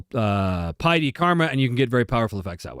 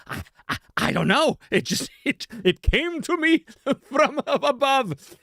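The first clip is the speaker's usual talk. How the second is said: stammering, then high-pitched wonder; mock amazement, drawn out at the end with a laugh; baffled; 11 semitones higher, slower than usual. Retold baffled answer to castmates.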